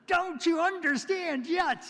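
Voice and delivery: sharp, high, loud exasperated demand; mock-exasperated cry at Thomas; exasperated shout. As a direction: shake him by the toga and yell it. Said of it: "pulled him close and said".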